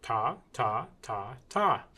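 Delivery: quick, even chant of "Ta". Shown as a demonstration. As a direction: tap out each "Ta" briskly on the beat. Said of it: "I can go faster".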